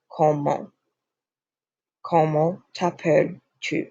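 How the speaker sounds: "Comment" ending through the nose, no T sounded; nasal ending. Shown as a demonstration. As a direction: let the end of "Comment" go nasal, S silent in "t'appelles". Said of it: "it goes through your nose and it ends nasally".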